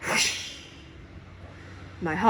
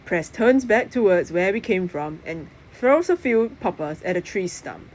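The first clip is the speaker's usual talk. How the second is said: usual speaking voice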